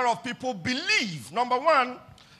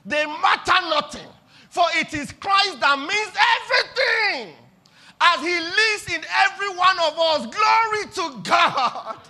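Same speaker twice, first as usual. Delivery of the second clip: loud, high preached climax; rising shouted preaching; preaching at full force; 6 semitones higher, 6 dB louder than usual. Real, not acted. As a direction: drive the truth home to the church.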